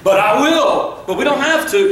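high-pitched, backpedalling bravado; mock bluster, pitched well up; trying to sound tough. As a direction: act tough while wriggling out of a fight. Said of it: "I'm still trying to be tough".

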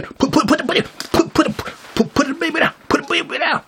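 high squeaky rapid-fire mock nag; nagging woman. Mocking the woman who wants the baby put down, squeaky nagging.

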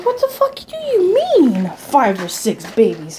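drawn-out, very high disbelief, falling at the end; slow, hammed-up incredulous question; mock incredulous. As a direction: retell it as exaggerated, squeaky-high bafflement. Retold incredulous reply to Joey.

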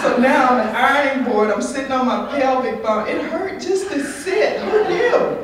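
laughing lament. Real, not acted. Telling the audience about her lost curves, laughing. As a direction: laugh at your own misfortune.

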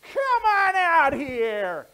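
very high, loud, slow drawn-out call; Mom calling out. Acted. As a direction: call the boy in from across the yard. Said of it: "It sounded like this".

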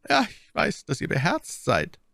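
high-pitched, indulgent cardinal; almost good-natured. A narrator's reading. Cardinal acknowledging D'Artagnan's courage, almost good-natured. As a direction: grant it kindly, pitched well up. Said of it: "in einem fast gutmütigen Ton".

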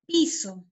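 "piso" with the first syllable stressed, falling away. Shown as a demonstration. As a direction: say "piso" leaning on the I, letting the O drop. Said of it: "con este acento, ¿no? En la I".

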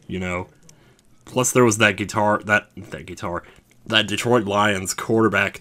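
ordinary talk, nothing marked

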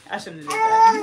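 high, laugh-crying. Real, not acted. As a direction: wail in mock regret.

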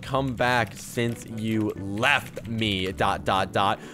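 slow, drawn-out dictation; low, word-by-word typing voice; dictating while typing. Real, not acted. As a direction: spell it out slowly as you type. Typing out a chat message.

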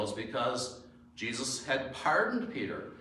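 ordinary talk

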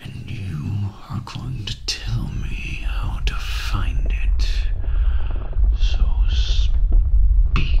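villain losing patience, loud whispered command; forceful whispered demand. Acted. Villain demanding answers from captive.

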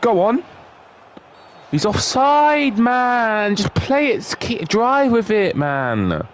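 real frantic shouting; yelling at players. Real, not acted.

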